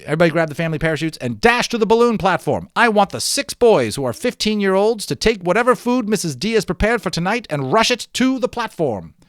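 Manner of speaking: fast, loud and high urgent commands; shouting orders. Acted. Leader rushing everyone to the balloons, fast urgent shouting. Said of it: "started shouting instructions".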